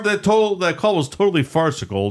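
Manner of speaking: high-pitched mock sportscaster; Al Michaels. Sportscaster debunking the prank call, high mock broadcast voice. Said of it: "And Al Michaels".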